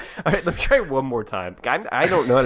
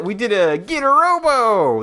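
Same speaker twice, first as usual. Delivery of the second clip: duck voice; 10 semitones higher, 5 dB louder than usual. Shown as a demonstration. Recalling a duck voice bit.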